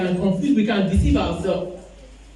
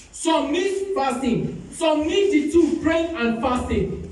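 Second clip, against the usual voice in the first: raised, high exhortation to the congregation; fervent preaching; 7 semitones higher than usual. Acted. Pastor exhorting the church to pray and fast, fervent preaching. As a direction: preach it out, pushing the pitch up high.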